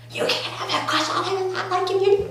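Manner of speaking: high-pitched, squealing indignation; mock outraged protest. Retold quarrel over shared crush.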